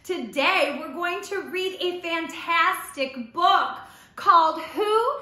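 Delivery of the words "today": ordinary talk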